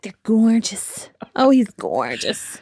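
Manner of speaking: slow, breathy swooning whisper; swooning teen. Retold or imitated. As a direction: swoon over a crush, drawn out. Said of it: "I probably talked a bit like this".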